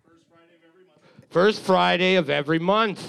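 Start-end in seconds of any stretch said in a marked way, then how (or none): 0.1-1.3 whisper. Acted. Whispered aside about trivia night.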